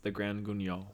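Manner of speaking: Grand Guignol said softly, ending in "nyol"; French pronunciation. Shown as a demonstration. Telling the co-host how it's pronounced, "Guignol" with a soft French gn. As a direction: say "Guignol" as "goon-yol", quietly. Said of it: "that's how it's pronounced".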